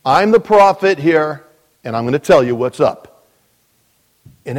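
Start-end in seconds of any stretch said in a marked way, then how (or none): 0.0-3.3 hammed-up domineering wife, louder; bossy Jezebel. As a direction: lay down the law like a self-made prophet.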